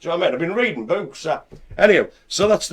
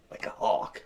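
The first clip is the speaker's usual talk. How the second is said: whisper; 8 semitones lower, 10 dB quieter than usual. Pretend. Jokey threat to a listener.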